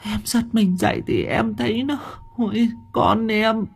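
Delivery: high, far-off crying wife's voice; a terrified wife half-sobbing to her husband; whimpering wife. A narrator's reading. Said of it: "Bà Hường mếu máo". (giọng vợ mếu máo, cao vút, sợ hãi)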